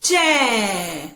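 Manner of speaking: "चै" drawn out long with a falling tone; ai-sound demonstration. Shown as a demonstration. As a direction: stretch the vowel in "चै" for learners. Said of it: "इसे हम बोलेंगे".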